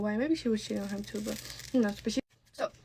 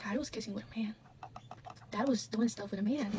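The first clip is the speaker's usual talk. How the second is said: hushed telling; 9 dB quieter than usual. Retold or imitated. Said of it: "I was like".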